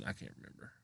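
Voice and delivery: breathy whisper aside. Real, not acted. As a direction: whisper it to yourself. Aside while recalling event.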